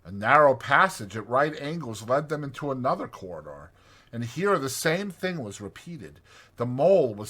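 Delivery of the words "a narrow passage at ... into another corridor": usual speaking voice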